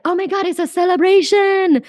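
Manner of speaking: high, loud gushing delight; hammed-up excited squeal; mock gushing. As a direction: gush like it's a party. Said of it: "It's not like".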